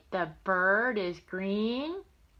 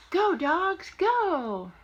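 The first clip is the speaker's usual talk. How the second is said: higher, louder sing-song refrain; reading to a child; 8 semitones higher, 4 dB louder, much slower than usual. Acted. Grandma reading to her grandson, lively title. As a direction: announce the title like a cheer.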